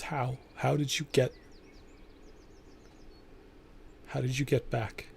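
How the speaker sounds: soft, halting questions, mildly shaken; shaken disbelief. Acted. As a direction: ask it quietly, stunned, with long breaks. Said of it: "starts to shake".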